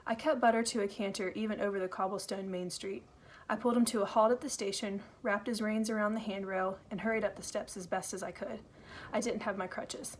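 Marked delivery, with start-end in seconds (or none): none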